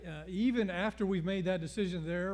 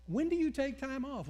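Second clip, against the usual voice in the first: high questioning; 9 semitones higher than usual. Retold or imitated. Pastor counseling dialogue.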